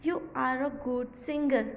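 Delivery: very slow, word by word; slow classroom reading; slow model reading. Shown as a demonstration. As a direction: read slowly for the class. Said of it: "फिर है".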